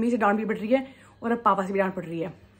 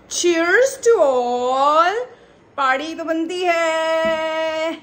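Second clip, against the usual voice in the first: higher, louder celebratory shout; excited cheer; 6 semitones higher, 6 dB louder, much slower than usual. Real, not acted. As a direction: toast everyone with joy. Celebrating the win, Diwali wishes.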